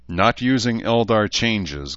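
ordinary delivery

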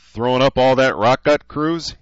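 bright, teasing question; cheerful teasing. Acted. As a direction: needle him cheerfully. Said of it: "he asked cheerfully".

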